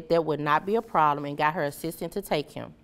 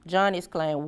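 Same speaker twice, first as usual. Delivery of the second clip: plain reading voice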